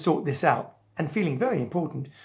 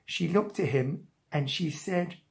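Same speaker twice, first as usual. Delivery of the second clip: ordinary delivery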